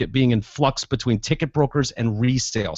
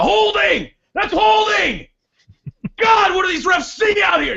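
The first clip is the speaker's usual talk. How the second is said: screaming at the refs. Retold or imitated. Annoying fan screams at refs during game. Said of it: "he's gonna scream it out".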